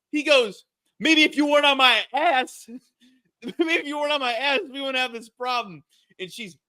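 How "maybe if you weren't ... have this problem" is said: high, indignant mock yell; retold road rage. Driver yelling at honking woman, imitated anger. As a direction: imitate a driver snapping back.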